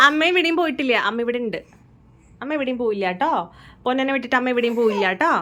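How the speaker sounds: mother reassuring her crying son, sing-song comfort. Real, not acted. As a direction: tell the little one mummy never left. Mother comforting baby who cried.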